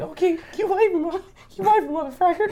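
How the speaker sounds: put-on bossy little kid giving orders; high mock child voice. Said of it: "I'm just like".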